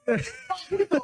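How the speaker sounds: high, breathy burst of laughter. Real, not acted. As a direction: crack up at the punchline. Laughs at the fight punchline.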